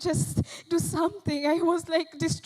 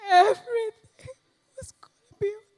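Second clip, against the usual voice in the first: repeating it louder as the sobbing takes over; breaking into tears; 8 semitones higher, 8 dB louder than usual. Acted. Mother pleading over her hurt child, sobbing. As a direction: voice cracks, building from steady to crying out.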